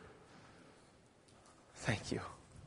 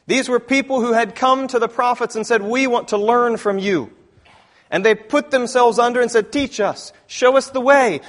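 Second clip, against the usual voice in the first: higher and louder, eager pleading; pleading disciples; 8 semitones higher, 9 dB louder than usual. Acted. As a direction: beg the teacher to come along. Voicing the prophets' sons pleading.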